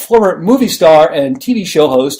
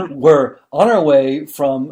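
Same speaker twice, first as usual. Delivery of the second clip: normal talking voice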